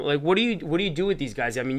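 ordinary talk, nothing marked

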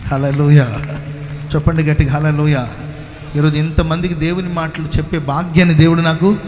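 loud, fervent preaching climax; full-force preaching. Acted. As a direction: preach it out to the whole church.

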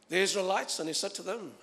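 normal talking voice

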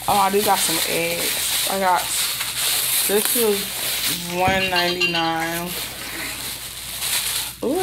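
ordinary talk

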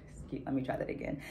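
quick breathy whisper to herself, fast; whispered aside. Real, not acted. Reader correcting a misread name, whispering to herself. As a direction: mutter the correction under your breath, quickly.

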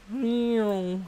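high, drawn-out imitated meow; cat meow. Imitates a cat during game.

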